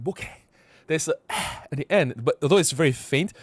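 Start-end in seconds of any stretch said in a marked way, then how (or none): 0.0-0.2 short "Boke" ending in an audible eh-h; H not silent. Retold or imitated. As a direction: say "Boke" with a puff of H on the end. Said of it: "the H is not silent".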